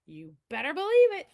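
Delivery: "big" stretched high and loud, then a punchy "You better believe it"; big showman refrain. Acted. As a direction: belt the catchphrase to the back row. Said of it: "Oh, say it with me, please".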